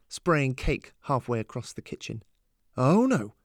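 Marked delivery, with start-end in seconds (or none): 2.8-3.4 loud, swooping protest; emphatic objection. A narrator's reading. Both parents objecting at once to their daughter, emphatic. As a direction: cut in loudly and refuse it. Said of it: "said both her parents almost at once".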